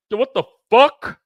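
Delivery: high, loud, indignant squawk of a friend; exaggerated imitation of an offended buddy; mock outraged rant. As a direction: ham up his outrage at being called a shill. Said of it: "He's like".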